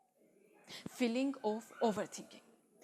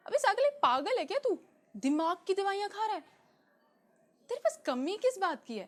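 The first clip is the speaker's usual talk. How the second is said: high, loud, jeering disbelief; mocking scorn; 7 semitones higher, 5 dB louder than usual. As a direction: taunt them as if they were crazy. Acting out scornful judging people.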